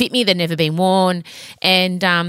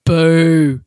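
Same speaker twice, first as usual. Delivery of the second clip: mock booing; 5 dB louder than usual.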